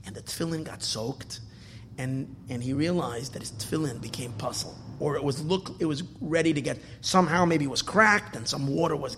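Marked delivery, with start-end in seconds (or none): none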